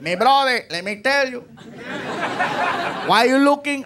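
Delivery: a hint of a Puerto Rican guy, friendly; Puerto Rican accent. Desk clerk greeting a tired guest, lightly voiced Puerto Rican accent. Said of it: "Puerto Rican".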